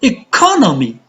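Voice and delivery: "Economy" with CO pitched high, then falling; stress on CO. Shown as a demonstration. Teacher demonstrating word stress, "Economy" stressed on CO. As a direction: punch the second syllable of "Economy", e-CO-nomy. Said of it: "two syllables before".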